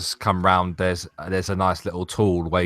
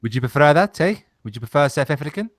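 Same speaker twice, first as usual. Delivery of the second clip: put-on South African accent, louder; 5 semitones higher, 4 dB louder than usual. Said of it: "I can do South African".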